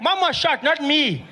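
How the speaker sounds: put-on high kid correcting; matter-of-fact little kid; little brother voice. Little brother corrects his panicked brother, high kid voice.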